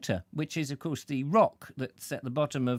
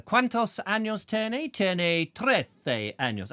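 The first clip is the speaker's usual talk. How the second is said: schoolboy Spanish; 4 semitones higher, slower than usual. Shown as a demonstration. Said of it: "I did Spanish at school".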